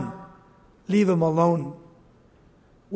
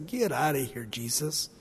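lower, softer scoffing dismissal; mocking; 5 semitones lower, 7 dB quieter than usual. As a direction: sneer at him. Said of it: "They ridiculed Jesus".

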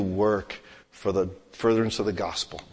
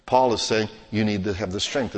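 ordinary talk

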